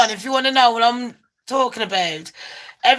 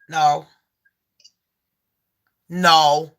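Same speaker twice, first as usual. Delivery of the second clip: firm refusal; 8 semitones lower than usual. Retold or imitated. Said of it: "I looked at him like".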